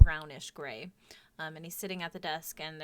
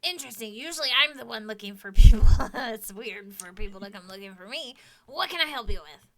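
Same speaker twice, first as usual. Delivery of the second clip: amused kobold investigator; lightly voiced kobold, chuckling; 12 dB louder than usual. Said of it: "I can't really do a different sounding kobold voice".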